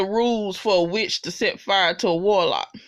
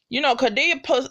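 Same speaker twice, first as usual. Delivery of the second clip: ordinary delivery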